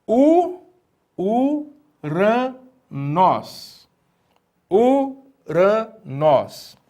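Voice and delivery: Uranos very slowly as u, ra, nós, each apart; syllable-by-syllable reading. Shown as a demonstration. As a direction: sound out uranos high and slow, long pauses between syllables. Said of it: "você consegue ler".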